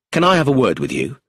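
quick, curt request; abrupt inspector. Acted. Inspector curtly demanding a word with the tutor, fast and abrupt. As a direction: clip it short and businesslike. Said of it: "Taverner was abrupt".

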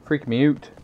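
Canadian-style "out" rhyming with boot; Canadian accent. Retold or imitated. Gamer repeating the Canadian quirk, Canadian accent. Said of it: "they say oot".

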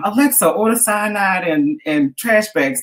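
imitating Cora ordering. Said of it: "to kinda show Chris how she ordered".

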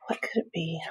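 hushed, secret question; suspense whisper. A narrator's reading. Librarian whispers a teaser question to the kids.

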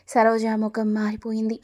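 plain reading voice

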